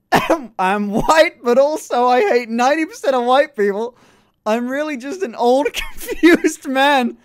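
high, loud, breaking into laughter; laughing while reading. Real, not acted. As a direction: read it while cracking up. Cracking up while reading the message.

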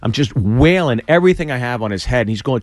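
normal talking voice

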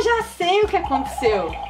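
normal talking voice

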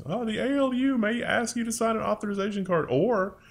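lightly mocking, high-pitched notice voice; high mock-concerned corporate reading; mock company notice. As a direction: read it out as a high, silly company warning. Mock reading of anti-union notice.

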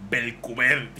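usual speaking voice

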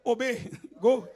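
"obey, go" said with a laugh; teasing push to obey; laughing command. Retold or imitated. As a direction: urge him on through a laugh. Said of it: "But I said".

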